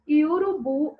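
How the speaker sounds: urubu said loudly with the final BU stressed; teacher showing the stressed BU in urubu. Shown as a demonstration. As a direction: lean on the last syllable of urubu. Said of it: "o bu é a sílaba tônica".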